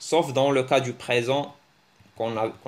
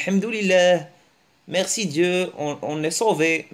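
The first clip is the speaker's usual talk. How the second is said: mock relief; 5 semitones higher, 4 dB louder than usual. Mock relief at seeing police.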